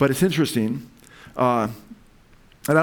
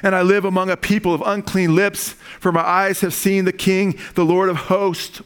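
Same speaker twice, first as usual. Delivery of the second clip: high, raised preacher's cry; impassioned lament; 4 semitones higher than usual. Acted. Isaiah confesses unclean lips before God, impassioned lament. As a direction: cry it out high, then proclaim.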